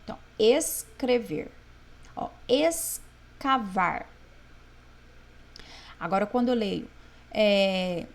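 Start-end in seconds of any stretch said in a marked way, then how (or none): 0.4-1.5 escrever with a long S, a break, then CREVER; S and C separated. Shown as a demonstration. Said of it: "eu fiz o som do S e do C". 2.5-4.1 escavar slowly, long ES, pause, then CAVAR; S and C separated. Shown as a demonstration. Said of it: "eu fiz o som do S e do C".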